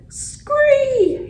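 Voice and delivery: loud, long falsetto shriek; yelled frog screech. A narrator's reading. Frog carrying hamster hops away, yelling. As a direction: let out a high piercing scree. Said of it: "he yelled".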